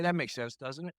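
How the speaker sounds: dropped soft and low at the end; casual tag question. Shown as a demonstration. Closer slipping a question onto a statement, soft and low. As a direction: slip it in quietly, almost throwaway. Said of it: "at the end of the statement, say".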